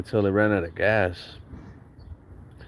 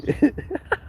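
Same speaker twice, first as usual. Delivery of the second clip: sudden squeaky laugh at himself; high burst of laughter. Real, not acted. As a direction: crack up mid-story. Laughing at his own kid voice.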